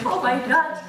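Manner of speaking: mild mock scream, pitched high; high-pitched, toned-down re-enacted scream; retold scream. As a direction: imitate an excited scream without much volume. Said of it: "running around my friend's house and screaming".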